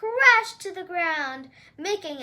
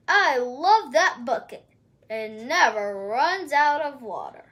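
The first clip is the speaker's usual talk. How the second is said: lower, louder giant boast; 5 semitones lower, 4 dB louder than usual. Acted. Giant praising his endless bucket, low and proud.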